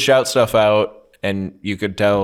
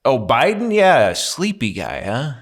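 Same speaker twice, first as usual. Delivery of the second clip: Mark Normand; 4 semitones higher than usual. Retold or imitated. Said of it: "He's like".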